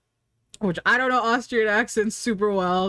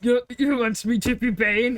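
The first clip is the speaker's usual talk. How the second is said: put-on half-hearted Austrian accent, cracking up; a shaky attempt at a tough Austrian movie star; imitating a burly Austrian action hero; Arnold Schwarzenegger voice. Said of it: "I can't do it".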